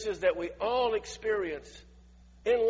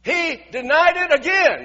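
ordinary delivery

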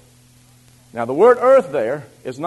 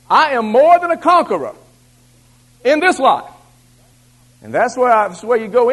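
loud, rising warfare prayer, pushed higher; preaching at full force; 5 semitones higher, 4 dB louder than usual. Acted. Preacher to the congregation, praying warfare at full force. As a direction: declare victory like a battle cry.

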